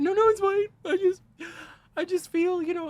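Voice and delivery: very high, loud tearful wail; mock crying. Man crying as he explains his feelings, hammed-up sobbing. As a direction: wail through imitated sobs, barely getting it out. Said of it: "So I start crying".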